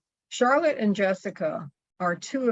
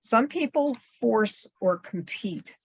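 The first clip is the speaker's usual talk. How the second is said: usual speaking voice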